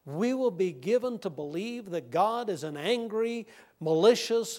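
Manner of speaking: ordinary talk, nothing marked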